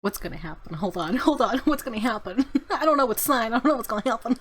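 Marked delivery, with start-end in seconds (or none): none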